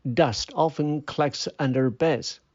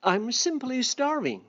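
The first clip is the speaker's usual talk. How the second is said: a higher, lightly voiced begging grasshopper; pleading grasshopper; 8 semitones higher, 3 dB quieter than usual. Grasshopper begs ants for food.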